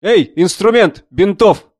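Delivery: high, loud, drawn-out call; doctor's shout. A narrator's reading. Army doctor calling his helper for supplies, shouting. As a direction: bellow orders to the assistant. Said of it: "закричал он".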